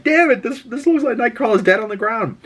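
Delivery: high-pitched, alarmed exclamation; nervous outburst. Retold or imitated. Viewer reacting to Nightcrawler lying on the ground, nervous. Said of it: "this part made me nervous".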